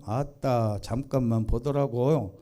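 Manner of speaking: ordinary talk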